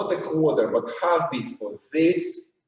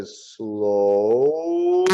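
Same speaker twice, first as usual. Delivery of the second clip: SLOW held long on one breath; drawn-out SLOW; 6 semitones lower than usual. Shown as a demonstration. Dance teacher showing the movement's length, drawn-out. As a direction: stretch the vowel of SLOW right through. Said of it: "do a little change through the top to go down".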